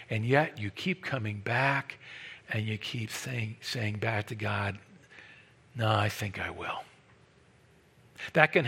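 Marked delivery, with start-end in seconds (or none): 0.1-6.8 hushed intimate preaching. Acted. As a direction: lean in and confess the stubborn answer quietly. Quiet confession of stubborn sinning.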